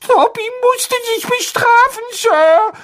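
very high, timid elf voice; a fretful little house-elf; squeaky house-elf voice. Acted. Dobby the house-elf speaks.

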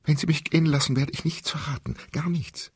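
high, pleading promise; panicked pleading. Acted. Captive woman pleads, promising silence, frightened. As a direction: promise anything to get free.

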